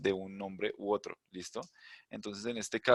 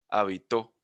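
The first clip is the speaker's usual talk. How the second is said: "Habitó" punched louder, accent on "tó"; stress on final syllable; 6 dB louder, slower than usual. Shown as a demonstration. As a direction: hit the last syllable of "Habitó". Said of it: "la sílaba que se pronuncia más fuerte va al final".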